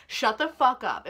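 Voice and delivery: loud, slowed-down exasperated order; fed-up, emphatic outburst; venting. Real, not acted. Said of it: "this is me just venting".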